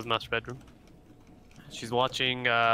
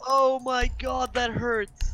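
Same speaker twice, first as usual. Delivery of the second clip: mock sympathy; 12 semitones higher, much slower than usual. Real, not acted. Teasing a teammate's death.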